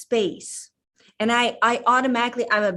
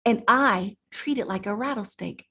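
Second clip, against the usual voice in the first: usual speaking voice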